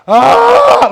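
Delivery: a sudden loud cry of despair; anguished cry. Retold or imitated. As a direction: cry out as if undone before God. People crying out before God.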